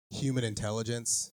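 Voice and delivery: normal talking voice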